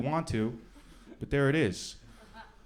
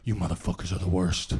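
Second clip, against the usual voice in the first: a hint of a low grumbling accuser, slowed; lightly voiced homeless man; 4 semitones lower than usual. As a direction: grumble it like a suspicious old man. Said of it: "He goes".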